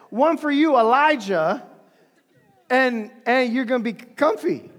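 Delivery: loud, nervous, laughing rambling; awkward Peter. Retold or imitated. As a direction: fill the silence with loud awkward chatter. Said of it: "and be like".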